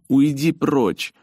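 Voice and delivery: high, slow, loud plea; urgent cry. Acted. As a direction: beg him to leave. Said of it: "прошипела она".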